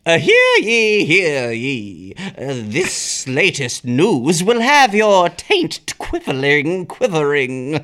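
olde-time herald, slow and grand; town crier. Pretend. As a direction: proclaim it like a medieval crier. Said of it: "do your ye oldie time skit".

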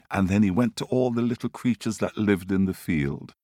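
plain reading voice

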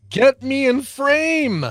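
slow drawn-out demand. Pretend.

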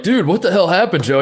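high, shocked "dude, what the hell"; mock alarm at a friend's injury; a stunned coworker gasping at the sight.

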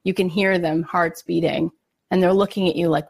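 ordinary talk, nothing marked

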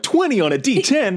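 1 mock disbelief. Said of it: "so it was like".

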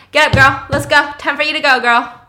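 loud, punchy wake-up call; acted call at full volume; rousing shout. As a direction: holler to rouse someone. Friend shouts to rouse the stripper.

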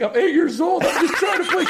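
put-on very high laughing eight-year-old; whiny kid, laughing through it. Son pleading with dad, high and laughing. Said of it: "He's like".

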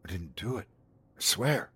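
hushed, small-voiced denial; murmured. A narrator's reading. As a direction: protest softly, almost under the breath. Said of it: "Lena murmured".

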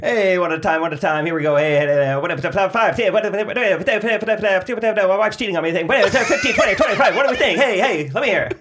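high, loud auctioneer chant. Pretend. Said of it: "That's a great character".

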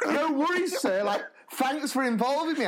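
put-on high, eager, laughing kid voice; a giggly, overly polite lad; polite pupil voice. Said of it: "Lucas was just like".

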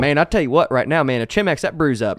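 hammed-up Southern accent. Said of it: "I'll just do it in my redneck voice".

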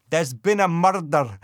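hammy Scottish detective; exaggerated Scottish detective impression; bad Scottish accent. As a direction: put on a dodgy Scottish accent. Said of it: "there's a Scottish detective".

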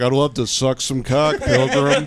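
John Wayne impression. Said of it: "sounding like John Wayne".

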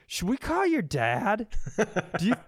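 normal talking voice

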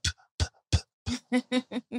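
soft, high dry spitting puffs; little repeated "p" puffs, like a baby copying; mimicked toddler spitting. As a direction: pretend to spit with an empty mouth. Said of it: "you brush and you kinda spit".

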